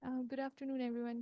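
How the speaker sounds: usual speaking voice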